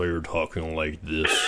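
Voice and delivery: low, slow disguised voice; disguised deep voice. Shown as a demonstration. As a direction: drop the voice way down and slow it. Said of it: "we can drop it down a few octaves".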